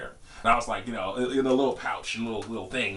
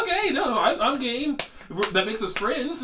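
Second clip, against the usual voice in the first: high-pitched, imitated easygoing agreement; eager compliance; 12 semitones higher, slower than usual. Telling how he played along with a supposed initiation, high-pitched agreeable. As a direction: play along in a much higher voice.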